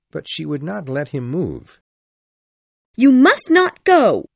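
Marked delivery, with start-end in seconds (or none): 2.9-4.3 very high, loud, slow plea; desperate cry. A narrator's reading. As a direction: beg him loudly to stay. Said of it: "she cried".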